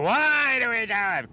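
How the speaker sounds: high, loud, slow question; mocking cop voice. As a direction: ham up the cop's question.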